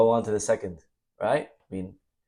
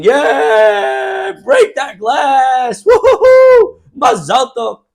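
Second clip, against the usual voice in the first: high, loud, whooping celebration shout; wild cheering; 13 dB louder, much slower than usual. Retold or imitated. Wedding guests whooping at the broken glass, ecstatic shout. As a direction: cheer like you've lost your head with joy. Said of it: "we're saying".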